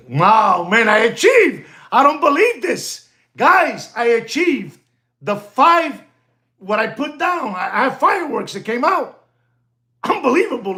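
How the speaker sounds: high-pitched, amazed exclamations; excited celebration. Real, not acted. Man telling viewers he achieved it, high and excited. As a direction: exclaim it high, as if you can't believe it.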